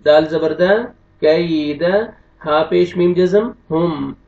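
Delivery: ordinary talk, nothing marked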